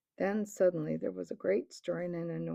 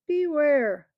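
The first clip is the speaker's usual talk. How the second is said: louder, higher, drawn-out warning; ominous warning; 7 semitones higher, 11 dB louder than usual. A narrator's reading. Tarkan warning the crowd, the loud second beware. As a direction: lean in hard on the second beware. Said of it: "the Tarkan warns the crowd".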